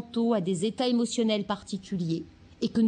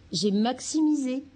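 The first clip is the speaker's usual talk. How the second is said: usual speaking voice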